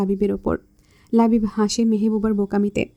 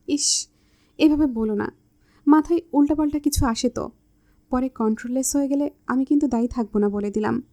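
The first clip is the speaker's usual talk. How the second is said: ordinary delivery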